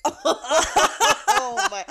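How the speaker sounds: high, loud, laughing reaction; laughing disbelief. Retold or imitated. Server reacting to customers saying hola, bursting with laughter.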